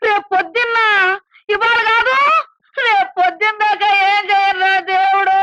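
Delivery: mother crying aloud when told to wait till morning; crying wail. Acted. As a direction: cry out to God in despair.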